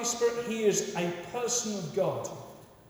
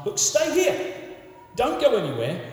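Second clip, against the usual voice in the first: urgent command; 8 semitones higher, 6 dB louder than usual. Acted. Jesus instructing his apostles.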